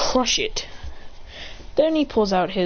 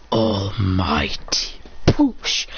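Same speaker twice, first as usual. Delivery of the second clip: slow low technique call; 13 semitones lower, much slower than usual. Retold or imitated. Hero casts his technique, slow deep intoned call. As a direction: drag it out very slowly, much lower. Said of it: "He goes".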